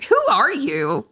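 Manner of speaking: high, incredulous outburst; mock disbelief. Questioning a friend who suddenly wants camping, mock disbelief. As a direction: demand it like they've become a stranger.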